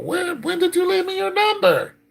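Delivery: very high, loud disbelief; astonished question. Real, not acted. Reacting to a number left for calling, astonished. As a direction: squeal the question in surprise.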